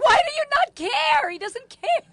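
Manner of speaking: high, loud, laughing disbelief; incredulous. Real, not acted. Host questioning a guest who doesn't care, incredulous. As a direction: demand an explanation, astonished.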